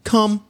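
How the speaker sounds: "Come" called out high and bright; calling come. Shown as a demonstration. Owner calling the dog over with a bright "Come". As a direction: sing out "Come" to call the dog. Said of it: "as soon as you say".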